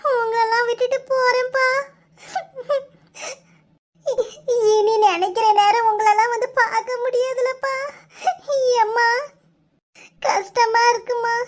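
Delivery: sobbing, high-pitched tearful voice; crying. Acted. Bride leaving her parents for the wedding, crying. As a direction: plead through sobs, voice pitched high. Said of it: "ஏட்டி, அழாத".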